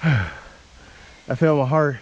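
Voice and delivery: winded and panting; catching breath after the climb. Real, not acted. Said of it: "I'm out of shape".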